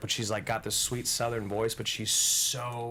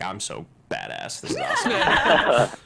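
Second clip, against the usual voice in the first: mock cocky brag; 3 semitones higher, 10 dB louder than usual. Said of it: "were you just like".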